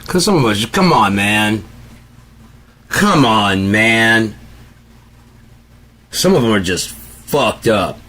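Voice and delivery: loud, high exasperated come on man. Real, not acted. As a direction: throw up your hands, exasperated. Exasperated rant about customers.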